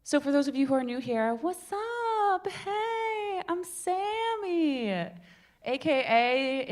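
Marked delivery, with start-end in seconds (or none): 1.3-6.7 bubbly intro. Real, not acted. Showy self-introduction to audience.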